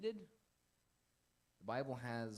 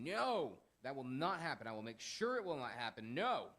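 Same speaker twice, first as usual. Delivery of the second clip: high, booming refusal; emphatic, loud veto; rebuking shout; 6 semitones higher, 10 dB louder than usual. Acted. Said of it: "He's rebuking the Lord".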